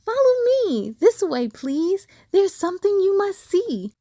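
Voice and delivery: a small deer beckoning eagerly; fawn's voice. Acted. Fawn voiced in the dream.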